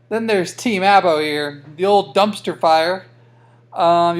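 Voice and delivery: ordinary talk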